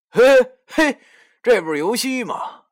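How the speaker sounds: sudden high, loud call from behind. Acted. Narrator calls out to Youxi from behind, high and loud. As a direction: hail him loudly to make him turn.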